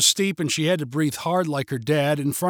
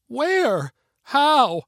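panicked; 9 semitones higher, 4 dB louder than usual. A narrator's reading. Panicked girl answering father.

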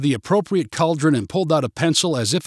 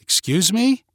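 high, rising disbelief; surprised; 7 semitones higher, 3 dB louder than usual. A narrator's reading. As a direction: query it as if caught off guard. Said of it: "turned around in surprise and said".

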